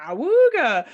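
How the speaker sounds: loud awooga call; awooga horn. Acted. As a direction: blurt out an awooga. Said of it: "random animal sounds".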